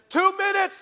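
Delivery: high called-out time cue; director's call. Retold or imitated. Director calling time to the studio, pitched high. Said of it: "the director says".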